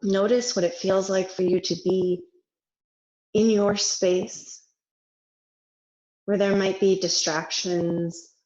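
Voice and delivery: unhurried meditation voice with long pauses; guided meditation. Real, not acted. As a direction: gently guide them into rest, letting each phrase settle.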